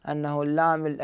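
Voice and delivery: normal talking voice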